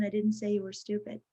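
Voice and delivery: "I didn't say you were stupid" on one low, soft note; no inflection on any word up to "stupid"; monotone. Shown as a demonstration. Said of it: "When they're monotone".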